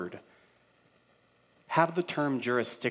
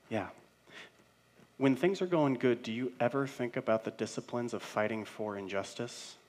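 ordinary talk

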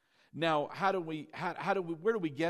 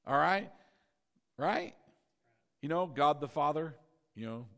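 normal talking voice

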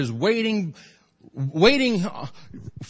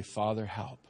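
quiet plea; 8 semitones lower, 10 dB quieter than usual. Shown as a demonstration. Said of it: "all you have to do is say".

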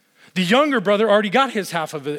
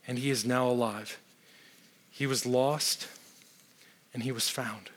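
tender father; 4 semitones lower than usual. Acted. Father gently answers his son.

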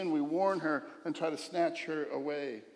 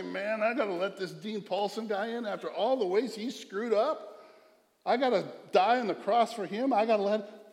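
higher, louder grudging complaint; mock grumbling God; 5 semitones higher, 4 dB louder than usual. God grudgingly complaining about letting a sinner in, mock grumble. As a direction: grumble it like a reluctant host letting a guest in. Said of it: "He's not gonna grumble and say".

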